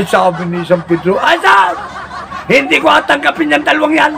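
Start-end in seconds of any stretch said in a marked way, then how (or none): 1.2-4.1 high annoyed Saint Peter; refusing Saint Peter. Acted. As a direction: refuse them firmly.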